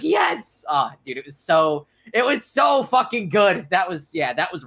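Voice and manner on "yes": excited yelp. Retold or imitated. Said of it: "and I was like".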